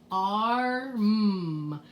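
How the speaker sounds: "arm" stretched out as "arrr-mmm"; letter-name AR sounded. Shown as a demonstration. Teacher shows mean R saying its name, "arm" drawn out as ar-mm. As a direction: draw out the "ar" and hum the M in "arm". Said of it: "it says its own name".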